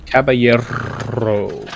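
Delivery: Caballero slowly, rolled r, ka-ba-YEH-ro. Shown as a demonstration. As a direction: stretch out Caballero with a trilled r. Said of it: "practice rolling my R's".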